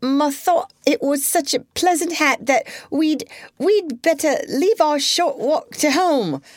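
high nervous garbled recitation; agonised recitation. A narrator's reading. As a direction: stumble through it in a panic. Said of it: "recited Sarah Maud in an agony".

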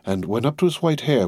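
ordinary delivery, nothing marked

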